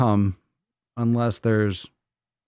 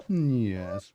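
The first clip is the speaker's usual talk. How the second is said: ordinary talk, nothing marked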